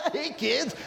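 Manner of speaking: high-pitched manic cackling greeting; goofy lunatic. Acted. Speaker mocking himself as a lunatic greeting kids, goofy and manic. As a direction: play a giggling lunatic calling out. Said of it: "just this, like, lunatic".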